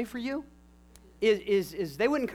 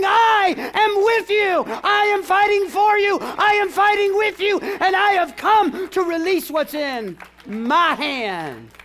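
slow, high ringing shout of reassurance; shouted voice of God; 7 semitones higher, 6 dB louder than usual. Acted. Preacher voicing God's promise to the church, slow ringing shout. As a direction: declare it like God fighting for them.

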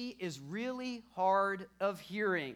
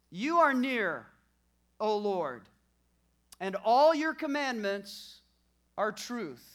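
preaching at full force; 8 dB louder, slower than usual. Acted. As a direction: drive the verse home to the congregation. Third repeat, preaching.

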